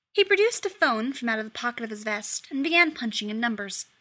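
ordinary delivery

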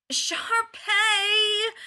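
high, loud wail for help. A narrator's reading. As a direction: wail it, desperate. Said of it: "Ryan wails".

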